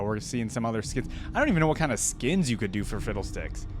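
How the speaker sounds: ordinary talk, nothing marked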